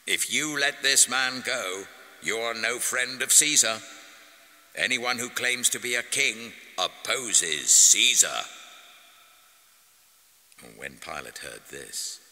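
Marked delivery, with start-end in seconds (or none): 0.0-8.4 shouting. A narrator's reading. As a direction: bellow the warning at the governor. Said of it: "kept shouting".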